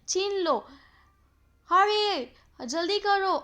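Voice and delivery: high, loud urgent command; imitated shouting. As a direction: cry it out as the storybook bird. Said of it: "चिल्लाते चिल्लाते कोई ये कह रहा है".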